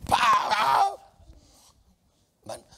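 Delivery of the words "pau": high shouted mock crash; preacher shouting a crash sound; loud crash effect. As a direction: bang it out like an impact.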